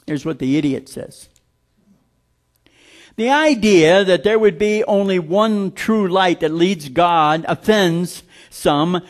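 normal talking voice

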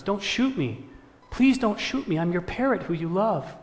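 high-pitched frightened begging; acted scared plea, pitch jumping up; pleading parrot. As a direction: beg for your life in a high voice. Terrified parrot begs farmer.

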